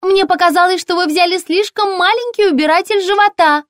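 very high, chirpy shop assistant; high-pitched, ringing sales voice; ringing saleswoman voice. A narrator's reading. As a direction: pipe up brightly through the door. Ringing-voiced saleswoman persists through door.

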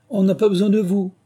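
high, loud indignant dismissal; offended rebuff. A narrator's reading. Offended mouse rebuffs Alice.

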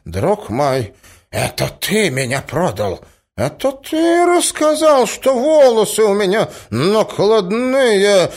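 rising from reproach to high, loud cry; betrayed old prince. A narrator's reading. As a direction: accuse him, wounded and slow. Prince accuses nephew of betrayal. (обиженно, с нарастающим криком)

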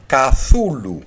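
Καθούλου drawn out slowly with an "a" after the k; American mispronunciation. Retold or imitated. Lecturer showing what Americans really said, slow mispronunciation. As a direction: imitate a struggling Καθούλου, vowel slipped in before th. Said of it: "για να μπορέσουν να το προφέρουνε".

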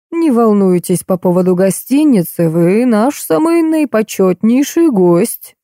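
usual speaking voice

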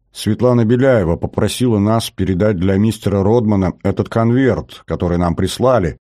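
plain reading voice

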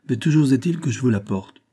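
ordinary delivery, nothing marked

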